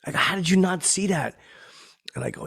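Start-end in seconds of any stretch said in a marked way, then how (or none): 0.2-1.4 high, loud retold disbelief; incredulous. Fan pressing the star about the Laugh Factory, incredulous. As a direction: press the question, pitched up in disbelief.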